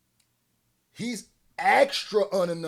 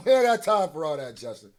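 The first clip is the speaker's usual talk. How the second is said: amused, raised-voice brush-off; louder dismissal; 6 dB louder than usual. Real, not acted. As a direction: wave it off louder, after a laugh. Same words repeated louder after laughing.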